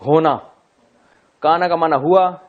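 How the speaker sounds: usual speaking voice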